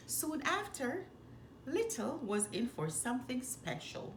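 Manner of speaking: ordinary delivery, nothing marked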